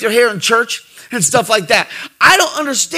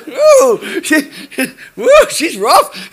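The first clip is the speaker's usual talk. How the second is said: laughing; 4 semitones higher, much slower than usual. Retold or imitated. Retold reaction to stern doctor.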